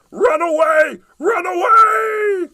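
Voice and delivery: high, loud panicked goblin shout. Acted. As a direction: shout it and flee. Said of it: "he cried".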